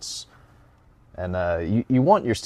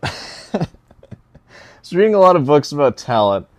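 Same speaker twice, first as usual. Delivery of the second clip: mocking read; 7 semitones higher, 10 dB louder than usual. Mocking reread of comment.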